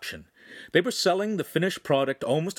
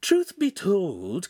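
high, drawn-out admission; slow, high-pitched character confession; confiding; 7 semitones higher, much slower than usual. A narrator's reading. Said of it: "confided Pan".

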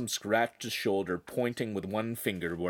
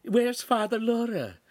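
high, motherly Italian hostess voice; high-voiced lady of the house; Italian signora; 12 semitones higher, 4 dB louder than usual. A narrator's reading. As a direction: play the chatty signora, pitched well up. Signora character speaking to newcomers.

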